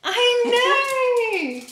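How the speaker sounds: high cooing reunion with a loved pet; excited pet greeting. Real, not acted. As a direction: squeal with joy at your darling. Greeting her pet after trip.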